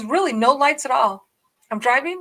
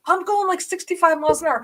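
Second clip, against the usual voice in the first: usual speaking voice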